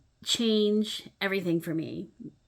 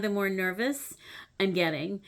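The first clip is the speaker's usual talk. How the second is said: ordinary talk, nothing marked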